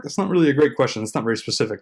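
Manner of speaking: ordinary talk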